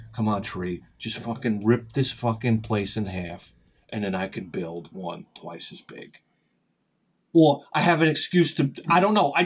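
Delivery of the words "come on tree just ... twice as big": muttered plea. Retold or imitated. Pleading with the tree.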